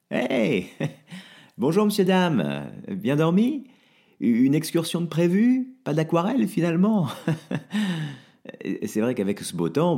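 nervous host's fake cheer; forced cheer. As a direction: chat up departing guests too brightly. Franck fakes cheer to guests.

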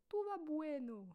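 "Tout va bueno" with a playful mock Spanish lilt, "bueno" bouncy; jokey fake Spanish accent. Telling viewers all is fine, in mock Spanish accent. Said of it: "mon accent espagnol".

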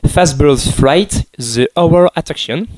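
slow English with a thick French accent. Real, not acted. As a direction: read the English title slowly with French sounds. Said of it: "excusez une nouvelle fois mon accent anglais absolument horrible".

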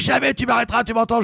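high, defiant mock shout; retold defiant yell. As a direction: throw it back at the boat in defiance. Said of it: "Je hurle dans la mer".

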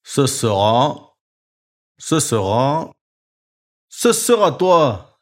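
slow, rising to a loud reveal; suspense build. A narrator's reading. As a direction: drag it out then point.

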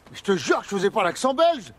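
ordinary talk, nothing marked

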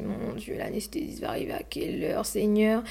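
whispered panicky thought; anxious whisper. Retold or imitated. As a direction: breathe the worry out under your breath. Anxious inner thought during labour.

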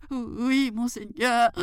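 high, meek mock fright; timid jackal. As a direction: answer meekly in a high voice. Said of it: "faisant semblant de trembler de peur". (chacal craintif, voix aiguë)

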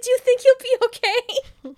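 hammed-up hysterical sorry; panicked high-pitched flamboyant host; frantic Ruby voice. Ruby fussing over the shot guy.

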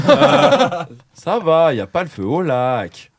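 slow, drawn-out Swiss accent; unhurried caricature of a Swiss French speaker. Shown as a demonstration. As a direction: take all your time, mock Swiss style. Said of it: "l'accent suisse, moi".

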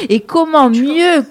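loud, high, slow build-up on mieux; emphatic opener. Acted. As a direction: announce it like a showman. Radio host's showy opening. (annonce radio exagérée)

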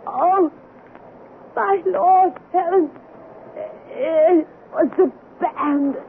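high, choked, slow dying cry; strangled voice. Retold or imitated. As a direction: gasp it out as if choking. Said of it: "in a strangled voice cried out".

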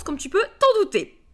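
high, loud mock-obvious cry; playful exclamation. Host joking about her birth year, playful loud squeal.

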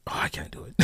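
breathy whisper of defeat; whispered give-up. Real, not acted. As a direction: whisper it as if too embarrassed to go on. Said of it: "giving up the accent".

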